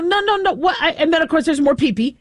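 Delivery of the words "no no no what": much higher startled no no no; rising panicked protest; alarmed protest. Retold or imitated. Owner panicking at the dog, high alarmed no. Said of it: "I go".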